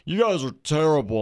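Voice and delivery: high, loud mocking whine; whiny jerk teammate voice; mocking rude teammate.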